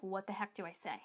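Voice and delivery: low, very soft aside; hushed mock bewilderment. Coach voices someone unsure how to close the sale, hushed bewilderment. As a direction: act out a private moment of being stuck.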